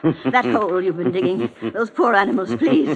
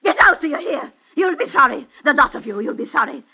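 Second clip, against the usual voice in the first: loud, high, raging tirade; shrill threats in fury; furious screaming; 7 semitones higher, 6 dB louder than usual. Acted. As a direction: yell them out of the house. Old woman screaming at her household.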